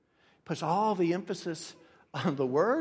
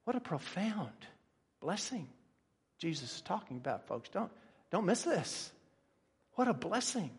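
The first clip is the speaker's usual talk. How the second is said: much softer, reverent preaching; hushed awe; 8 dB quieter than usual. Real, not acted. Preacher urging folks not to miss the blessing, hushed. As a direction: drop right down and marvel quietly.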